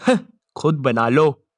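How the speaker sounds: low-pitched, unbothered vendor voice; dismissive seller. Acted. Gola seller tells the kid to make it himself, low dismissive voice. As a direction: drop low and brush the customer off. Gruff seller brushes customer off.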